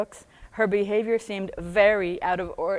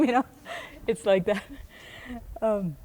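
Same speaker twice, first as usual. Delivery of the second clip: normal talking voice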